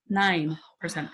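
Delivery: "Nine" slow and heavy, "percent" trailing; slow down and lean hard on "Nine"; stress on nine. Shown as a demonstration. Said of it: "I didn't say ninety, I said nine".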